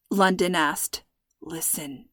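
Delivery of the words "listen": barely voiced, very soft; breathed. A narrator's reading. Dad hushing his daughter, barely breathed. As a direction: breathe the word. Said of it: "Dad breathed".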